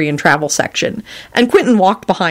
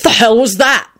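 startled shout, pitched up; mild shout; 6 semitones higher than usual. A narrator's reading. Quentin reacting to a bang and shriek, mild shout. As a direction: call out sharply in alarm. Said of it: "Quentin shouted".

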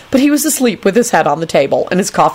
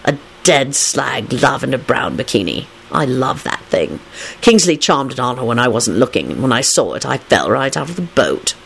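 dramatic; 5 semitones lower, 3 dB quieter than usual. A narrator's reading. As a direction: recount it with languid drama. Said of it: "he told Rosie dramatically".

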